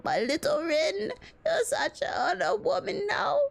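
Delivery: put-on high, slow, teary old man; weepy doting great-grandpa. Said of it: "uh, an old man voice".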